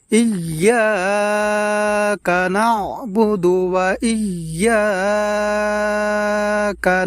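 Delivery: slow melodic chant; Quran recitation. Shown as a demonstration. Teacher reciting the verse to viewers, slow chant. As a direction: recite slowly with held vowels. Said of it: "এখানে আমরা".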